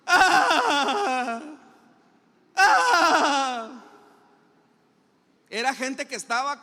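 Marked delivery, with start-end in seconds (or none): 0.1-3.8 loud, high hammed-up fake wail of hired mourners; mock wailing mourners. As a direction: wail like a paid mourner, overdone on purpose. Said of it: "a simular que estaban llorando". (lamento fingido y exagerado de plañidera)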